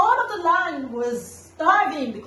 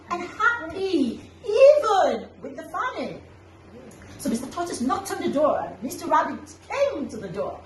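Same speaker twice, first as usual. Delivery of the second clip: ordinary delivery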